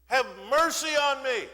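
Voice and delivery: high, loud desperate plea; crying out. Acted. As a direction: beg for mercy at full voice. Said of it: "that's when he cries out".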